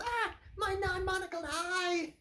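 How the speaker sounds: very high, squeaky cartoon-villain wail; Penguin's cry. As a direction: shriek it like a wounded comic-book villain. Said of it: "he goes".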